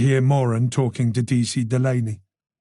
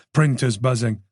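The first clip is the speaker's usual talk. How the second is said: usual speaking voice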